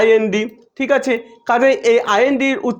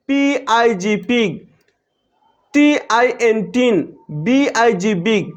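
teacher drawing out letters for learners; slow spelling demo; much slower than usual. Shown as a demonstration. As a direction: slowly spell each word, then say it. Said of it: "অনুরূপভাবে".

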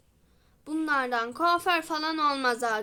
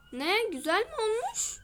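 ordinary talk, nothing marked

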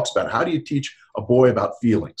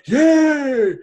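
high, loud cheering yay; a kid's triumphant yay; 8 dB louder than usual. Retold or imitated. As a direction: celebrate a touchdown. Cheering boy after a touchdown.

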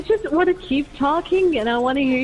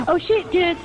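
excited alarm; 6 semitones higher, 4 dB quieter than usual. Real, not acted. Sudden danger in game.

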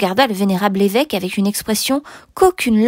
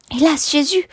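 higher-pitched mild lament; lamenting dismay; 6 semitones higher than usual. Acted. Housekeeper laments to the bishop, dismayed. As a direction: exclaim in pious dismay.

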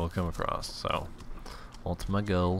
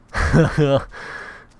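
loud, high burst of laughter; 7 semitones higher, 7 dB louder than usual. Real, not acted. Gamer amused at own death, loud laugh. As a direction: crack up out loud.

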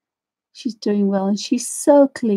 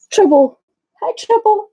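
pet talk; 11 semitones higher, 5 dB louder than usual. Real, not acted. Greeting the pet dog.

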